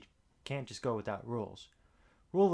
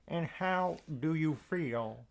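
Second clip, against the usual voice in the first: Doctor Phil impression; 4 semitones higher, slower than usual. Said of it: "Doctor Phil interrupted by saying".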